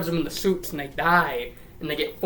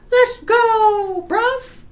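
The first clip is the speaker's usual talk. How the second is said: very high, loud squeal; squeaky hammed-up whoop; mock triumphant cheer; 7 dB louder, much slower than usual. Girl taking over the robot, high excited cheer.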